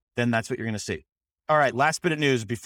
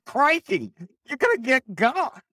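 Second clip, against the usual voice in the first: high, hammed-up Australian accent; badly faked Aussie yelp; 14 semitones higher, 3 dB louder than usual. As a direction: ham up a high-pitched Australian caricature. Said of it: "that's a bad Australian accent".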